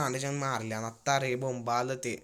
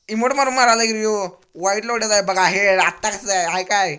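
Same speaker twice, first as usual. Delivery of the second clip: excited shout; 4 semitones higher, 8 dB louder than usual. Real, not acted.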